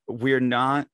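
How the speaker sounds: ordinary talk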